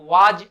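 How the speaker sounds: was as waaj with final j; wrong pronunciation. Shown as a demonstration. Teacher rejecting a mistake, wrong pronunciation of was. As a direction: say was with long aa and a j ending. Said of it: "or was from any angle".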